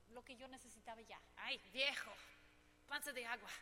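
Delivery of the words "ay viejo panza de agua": teasing loud piropo. Retold or imitated. Wife teasing her husband with a loud piropo, playful. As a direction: call out the Mexican compliment loud, teasing. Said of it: "ese es piropos mexicanos".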